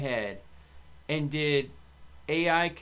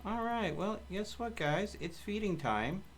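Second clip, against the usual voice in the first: higher, softer voice for the animals; sing-song pet talk; 5 semitones higher, 6 dB quieter than usual. Real, not acted. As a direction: coo to the animals in the pen. Talking to animals at feeding time.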